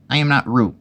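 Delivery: high, loud, insistent; loud protest. A narrator's reading. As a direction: protest loudly as a small animal. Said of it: "said Piglet loudly".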